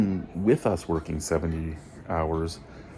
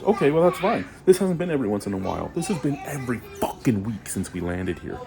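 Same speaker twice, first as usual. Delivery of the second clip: exasperated outburst; 5 semitones higher than usual. Real, not acted. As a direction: let the anger spill out fast. Angry rant at company.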